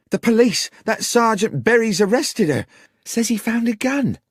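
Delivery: high, loud, distraught; frantic. Acted. Sarah explains Beth's arrest, still frantic. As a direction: spill it out in a panic. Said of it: "came screaming into the bar".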